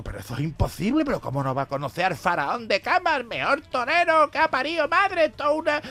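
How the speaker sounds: agudo, escandalizado, subiendo de tono; indígnate por lo de Curro; aficionado indignado. Acted. (aficionado indignado con el guiri)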